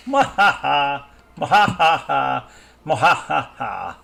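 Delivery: loud, slow villain cackle; mad scientist laugh. Pretend. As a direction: cackle like a gloating evil genius. Gloating over immortal emperor.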